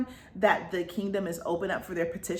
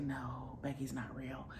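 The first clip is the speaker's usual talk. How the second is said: scared near-whisper; 6 semitones lower, 14 dB quieter, slower than usual. Retold or imitated. The scared girl admits the lie.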